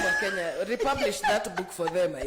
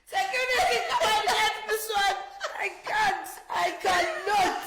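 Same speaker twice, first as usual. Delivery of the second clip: mock panicked shout; 9 semitones higher than usual. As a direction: wail it like a hammy emergency. Mock panic about dropped notes.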